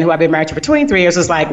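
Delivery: ordinary delivery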